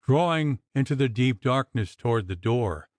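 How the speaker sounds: ordinary delivery, nothing marked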